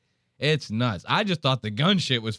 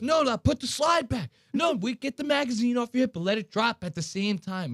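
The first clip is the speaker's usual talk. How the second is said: high-pitched mock instructor orders; hammed-up shouted correction, easing at the end; imitated yelling; 9 semitones higher than usual. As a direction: fire off orders like a frantic trainer. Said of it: "He's yelling".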